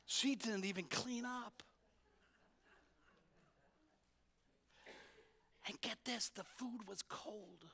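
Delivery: hushed, gossipy whisper-tone; gossipy neighbour. Retold or imitated. Neighbours gossiping about Martha, hushed and gossipy. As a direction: dish the dirt behind her back. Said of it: "the gossip going around".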